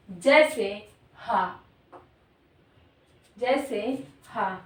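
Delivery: हा said slowly with a clear breathy h from the throat; slow teacher's demo of हा; slow sound demo. Shown as a demonstration. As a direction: model हा slowly for students. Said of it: "उसे काकल्य वर्ण कहते हैं".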